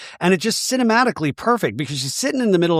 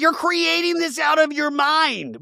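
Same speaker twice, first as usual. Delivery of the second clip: much higher, slow mock Heather; imitating Heather; 12 semitones higher, slower than usual. Recapping Heather brushing Lisa off, slow mock voice. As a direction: drag it out, dismissive. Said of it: "And Heather's like".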